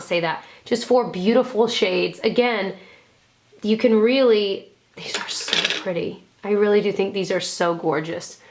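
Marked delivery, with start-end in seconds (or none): 5.0-6.2 soft, voiceless gush of admiration; awed whisper. Real, not acted. Vlogger admiring her favorite quad, awed whisper.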